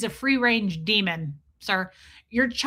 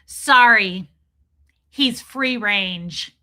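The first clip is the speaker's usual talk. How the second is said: mock smug dad; 7 dB louder, much slower than usual. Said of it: "he goes".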